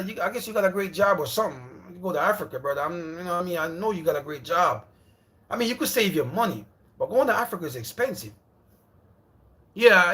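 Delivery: ordinary talk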